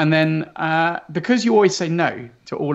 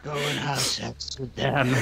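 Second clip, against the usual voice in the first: hushed aside; 3 semitones lower, 9 dB quieter than usual. Pretend. Mock trailer aside.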